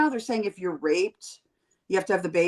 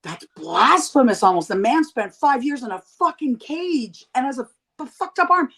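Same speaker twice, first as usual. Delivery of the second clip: appalled disbelief, rising high on the end; indignant outrage; 4 semitones higher, 4 dB louder than usual. Retold or imitated. Recalling her outrage at Trump mocking McCain, appalled and indignant. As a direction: voice cracking up with offence at an insult to a hero. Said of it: "I was like".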